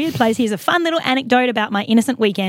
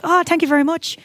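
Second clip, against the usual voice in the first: lightly imitated Irish man, higher pitch; Irish accent; 7 semitones higher than usual. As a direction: thank them in a light Irish lilt. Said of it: "he's Irish".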